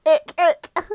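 disgusted ick. Real, not acted. Disgust at Discord light mode.

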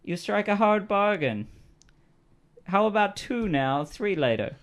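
high-pitched bargaining character; quest-giving character. Acted. Quest giver haggling over the down payment, high character voice.

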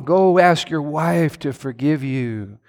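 normal talking voice

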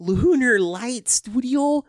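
very high, slow, loud Midwest mom question. Acted. Co-host asks about the network as a Midwest mom. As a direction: stretch it out as an astonished Midwestern mom. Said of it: "Except for the Midwest mom".

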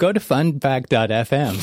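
loud cheery promo voice; hold message announcer. Pretend. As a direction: pitch the website like a hold ad. Mock hold ad.

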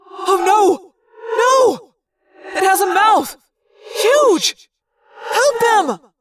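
high, loud panicked pleading; panicked cry. A narrator's reading. Tobias begging Jake to help the others, panicked high cry. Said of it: "Tobias cried".

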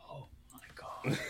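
hushed, unvoiced mutter to oneself; frustrated whisper. Real, not acted. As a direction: whisper it while racking your brain. Struggling to recall actor's name.

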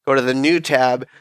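usual speaking voice

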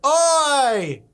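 high, loud mock Aussie "oi"; Australian accent. Co-host attempting the requested voice, hammed-up Australian accent. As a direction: call out in an exaggerated Australian accent. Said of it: "do a Valley Girl as, as a Australian".

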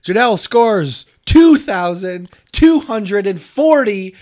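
loud, high game show announcer. Pretend. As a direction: announce the score with fanfare.

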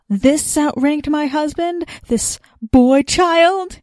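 mock outrage at a boy; scornful woman. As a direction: drag out the disbelief. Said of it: "the woman was pointing at Paul".

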